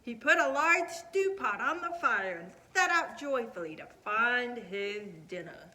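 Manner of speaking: ordinary delivery, nothing marked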